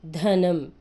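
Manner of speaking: ధనం with a loud, breathy ధ; aspirated demo. Shown as a demonstration. Teacher showing the aspirated letter to kids. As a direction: push air on ధ in ధనం. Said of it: "దా మహాప్రాణాక్షరం".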